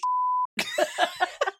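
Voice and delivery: long high burst of laughter. Real, not acted. Co-host cracking up at the T-shirt joke, high laugh. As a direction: laugh hard at your own joke.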